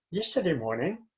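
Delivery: "Yesterday morning" rising at the end; rising tune. Shown as a demonstration. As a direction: let "Yesterday morning" lift at the end, unfinished. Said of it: "that's why I go up a little bit".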